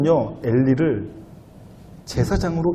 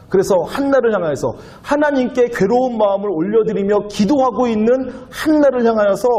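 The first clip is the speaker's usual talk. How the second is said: ordinary talk, nothing marked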